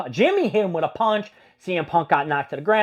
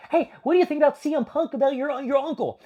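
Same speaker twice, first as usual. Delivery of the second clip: interviewer; 9 semitones higher than usual. Retold or imitated. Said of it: "this interviewer was".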